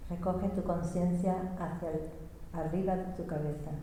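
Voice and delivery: very soft, hushed interpreting; guided meditation. Real, not acted. Interpreter gathering listeners' awareness at the head, meditative.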